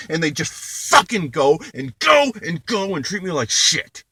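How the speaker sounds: furious shouting rant. Real, not acted. Shouting back at sister who hid her help, furious. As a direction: yell it all out at her.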